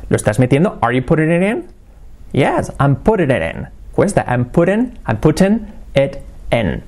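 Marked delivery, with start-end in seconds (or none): none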